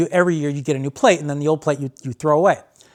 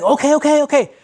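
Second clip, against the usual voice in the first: eager agreement; 10 semitones higher, 4 dB louder than usual. Retold or imitated. Stranger agrees excitedly.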